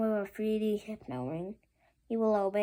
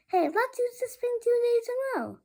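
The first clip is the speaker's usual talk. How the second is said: put-on much higher sneaky kid; squeaky kid voice; 14 semitones higher, 5 dB louder than usual. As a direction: pitch way up like a scheming kid. Kids plan to break rule.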